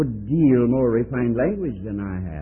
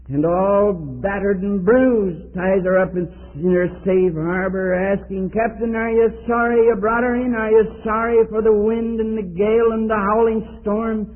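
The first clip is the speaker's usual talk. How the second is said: impassioned preaching; 8 semitones higher, 4 dB louder than usual. Acted. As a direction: preach it out in long, raised phrases. Preaches with raised, drawn-out voice.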